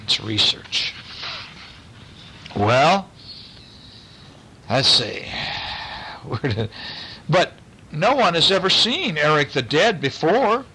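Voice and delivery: usual speaking voice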